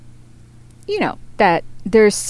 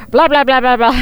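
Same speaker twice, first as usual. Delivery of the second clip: auctioneer patter; 5 semitones higher, 6 dB louder, faster than usual. Pretend. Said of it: "I probably sound like an auctioneer".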